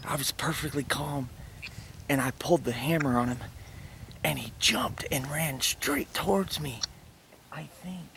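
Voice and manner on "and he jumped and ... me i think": whisper. Real, not acted. Hunter whispering in tree stand.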